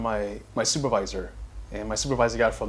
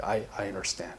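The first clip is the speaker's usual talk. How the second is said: empathetic understanding; 5 semitones lower, 11 dB quieter than usual. Retold or imitated. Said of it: "more empathy, like".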